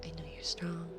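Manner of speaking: whispered encouragement; reassuring whisper. Real, not acted. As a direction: whisper it close, like a secret comfort.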